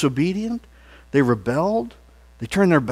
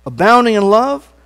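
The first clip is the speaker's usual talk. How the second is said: leading praise; 2 semitones higher, 4 dB louder than usual. Shown as a demonstration. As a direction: lift the congregation's voices. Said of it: "Lord, you're slow to anger".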